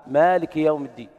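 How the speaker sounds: chanted مالك with the alif held long. Shown as a demonstration. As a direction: recite مالك with a long a, maalik. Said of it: "فقرأ بالإثبات".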